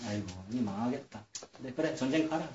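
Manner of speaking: quiet, low imitation of a beaten man giving in; acted weary aside; resigned muttering. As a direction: mumble it softly, as if giving up. Battered prophet's resigned reply, acted.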